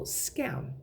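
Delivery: ordinary talk, nothing marked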